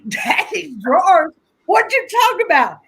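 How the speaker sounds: high, incredulous echo of his words; as if she can't believe what she heard; surprised disbelief. Retold or imitated. Sister echoes brother's question in shocked disbelief.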